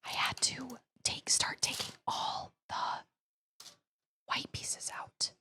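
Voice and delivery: soft whisper. Real, not acted. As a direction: whisper close to the mic. Streamer whispers throughout.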